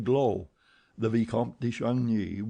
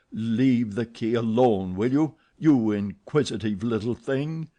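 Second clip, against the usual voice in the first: louder threatening command; menacing demand; 4 dB louder than usual. Acted. Eric threatening Christine over the key. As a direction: warn her, then demand the bag.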